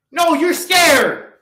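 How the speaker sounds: calling out fearful viewers with a shout. Real, not acted. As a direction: yell it at them. Calling out the audience.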